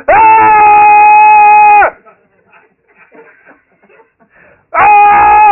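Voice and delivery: loud high pained yell, imitating the man down the hall; imitated yelling. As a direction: let out a loud anguished cry as the guy in the cell. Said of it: "He keep yelling".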